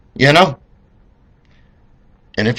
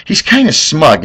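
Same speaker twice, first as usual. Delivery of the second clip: usual speaking voice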